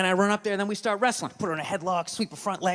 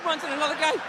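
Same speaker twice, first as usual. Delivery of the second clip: ordinary delivery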